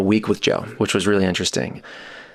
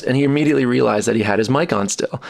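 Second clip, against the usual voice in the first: ordinary talk, nothing marked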